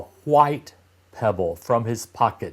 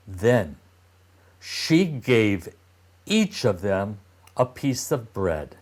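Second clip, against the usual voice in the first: ordinary delivery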